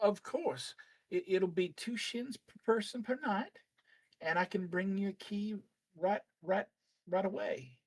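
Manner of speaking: high, quick chatty innkeeper lady; bustling little innkeeper, eager to help; innkeeper woman voice. Acted.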